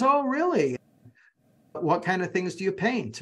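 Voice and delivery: sudden high "Oh, really?" then eager question; imitating an impressed stranger; mock surprise. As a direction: play the stranger's bright surprised interest. Stranger quoted on train.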